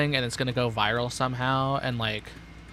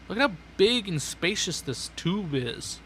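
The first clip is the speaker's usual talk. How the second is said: higher mock salesman showing off; higher lightly put-on presenter voice; showroom voice; 7 semitones higher than usual. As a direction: present it like a realtor. Showing off the tube.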